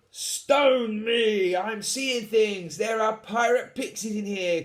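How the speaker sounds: high, loud disbelieving shout; shocked shout. Acted. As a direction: yell as if seeing things. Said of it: "croaked the biggest".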